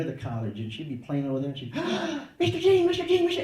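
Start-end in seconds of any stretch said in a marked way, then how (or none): 1.8-2.4 high, delighted gasp; excited gasp. Retold or imitated. Little girl spotting her favorite visitor, excited gasp. Child spots a visitor she adores. 2.4-3.4 put-on loud, very high little-girl squeal; excited child's call. Little girl calling her father figure, excited shout.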